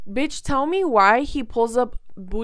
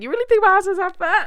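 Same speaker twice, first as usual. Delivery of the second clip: high, loud, quick mock-flattered squeal; 9 semitones higher, 7 dB louder, faster than usual. Woman retelling her reply to her ex, loudly flattered. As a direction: retell it as a delighted, rushed question. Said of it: "low-key kinda flattered".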